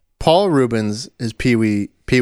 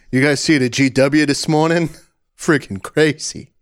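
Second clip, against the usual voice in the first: put-on New Jersey accent; 4 semitones higher than usual. Regular chatting to diner staff, New Jersey accent. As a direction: gripe about bridge traffic like a Jersey local. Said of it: "And then I say".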